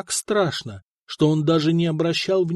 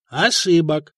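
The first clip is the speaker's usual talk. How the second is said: "Ошибок" pressed hard and louder; "Ошибок" said with weight, as a rebuke; emphatic; 4 dB louder, slower than usual. A narrator's reading. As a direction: lean on "Ошибок" firmly. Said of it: "с нажимом произнёс Коста".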